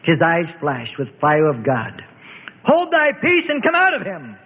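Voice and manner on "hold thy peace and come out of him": high, forceful rebuke with authority; stern command. A narrator's reading. Jesus commands the demon to leave the man, forceful rebuke. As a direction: order the spirit out with divine fire.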